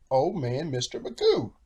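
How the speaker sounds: plain reading voice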